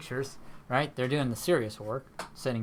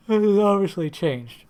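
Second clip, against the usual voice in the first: talking through a yawn, higher and louder; 8 semitones higher, 11 dB louder than usual. Real, not acted. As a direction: let a real yawn swell under the words. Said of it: "sorry about y-, yawning".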